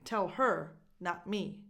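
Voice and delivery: "her" punched in contrast to me; stress on HER. Shown as a demonstration. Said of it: "If we stress her to emphasize it".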